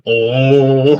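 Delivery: long, loud held "oh"; imitated yelling. Musician imitating the figure yelling at cars, loud and held. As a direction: mimic a drawn-out yell. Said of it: "he's just, like, yelling".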